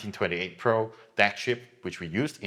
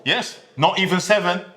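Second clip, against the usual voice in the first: excited reveal; 11 semitones higher, 7 dB louder than usual. Real, not acted. Teasing price reveal to interviewer.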